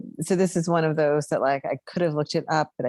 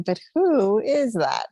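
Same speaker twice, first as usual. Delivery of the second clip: slow, higher baffled question, WHO drawn out; mock bafflement, stretched and pitched up; acted puzzlement; 5 semitones higher, slower than usual. Podcaster retelling her thought watching the episode, baffled.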